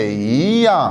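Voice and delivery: ई या with ई rising, या falling; yí yà tone demo. Shown as a demonstration. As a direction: say ई in second tone before या. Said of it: "इसको हम ई या की बजाय क्या कहेंगे".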